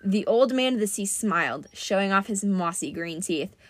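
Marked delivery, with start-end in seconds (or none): none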